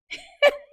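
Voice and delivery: high, loud burst of laughter; short laugh. Real, not acted. Storyteller amused before the punchline, short laugh.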